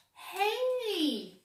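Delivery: high call from a cat. A narrator's reading. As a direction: shout hey up high. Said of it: "said the voice".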